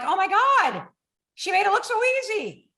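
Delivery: high, loud amazed gasp of a line; retold awe. Watching her friend jump, high amazed voice. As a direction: marvel at how easy she made it look. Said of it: "and I'm like".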